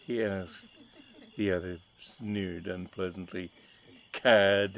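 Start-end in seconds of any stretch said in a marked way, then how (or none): 4.1-4.8 mustache twirling villain. Acted. Villain sneers.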